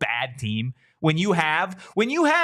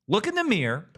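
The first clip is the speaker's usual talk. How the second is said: usual speaking voice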